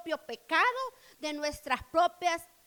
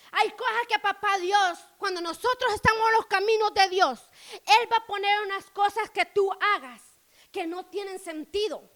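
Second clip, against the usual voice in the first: ordinary talk, nothing marked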